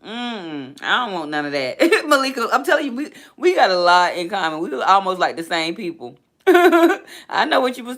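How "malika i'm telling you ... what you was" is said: high, loud laughing talk; real giggles breaking through. Real, not acted. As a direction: laugh along with a friend. Laughing with viewer Malika.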